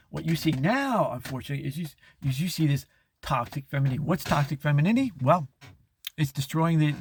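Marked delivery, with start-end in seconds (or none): none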